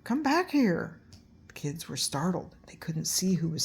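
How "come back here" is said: high eerie call from the dark; spooky voice. A narrator's reading. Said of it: "heard a spooky voice".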